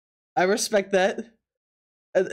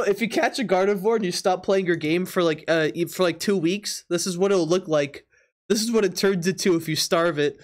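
usual speaking voice

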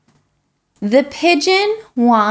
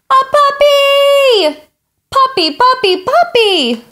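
high, loud, drawn-out squeal of delight; the pigeon bursts with joy at the word puppy; thrilled, much higher pitched calls; excited cry; 13 semitones higher, 5 dB louder than usual. Acted.

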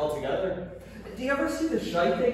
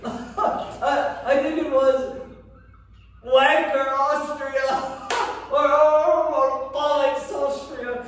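laughing; 8 semitones higher, 6 dB louder, much slower than usual. Acted. Laughing over funny place names.

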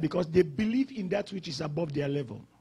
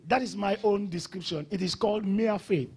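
ordinary delivery

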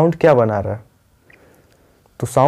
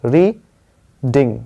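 slow reading split as री, pause, ding; syllable per jump; 2 semitones lower, much slower than usual. Shown as a demonstration. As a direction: count out reading in two jump beats. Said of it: "जैसे आपने jump किया".

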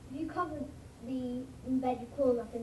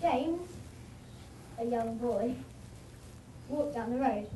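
sail up on James, drop for the clause, climb back; tone dips for the clause. Shown as a demonstration. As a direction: tone drops into the clause and returns. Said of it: "So it would just be".

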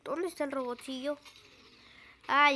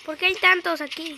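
loud alarmed question; 3 semitones higher, 12 dB louder than usual. Real, not acted. Overwhelmed by enemies.

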